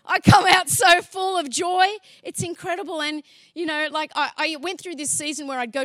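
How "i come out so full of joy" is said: joyful shout. Real, not acted. Exclaiming his joy at the job.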